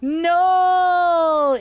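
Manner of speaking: high, loud wail of no; hammed-up loud despairing cry; anguished no. Friend's despair acted out.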